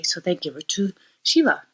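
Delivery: ordinary delivery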